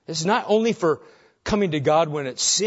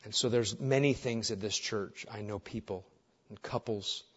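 low, soft pastoral prayer; leading prayer; 7 semitones lower, 7 dB quieter than usual. Real, not acted. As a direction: pray quietly on behalf of the congregation, lower than preaching. Leads the congregation in prayer.

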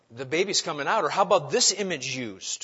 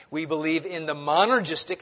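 usual speaking voice

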